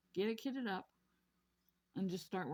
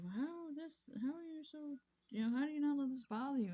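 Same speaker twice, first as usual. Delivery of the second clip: quoting puzzled people; 7 semitones higher than usual. Retold or imitated. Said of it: "people are like".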